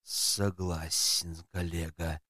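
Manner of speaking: low, slow agreeable voice; deep slow yes-man assent; agreeing. Acted. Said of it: "поддакнул Ус".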